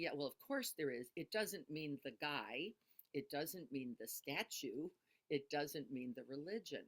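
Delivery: normal talking voice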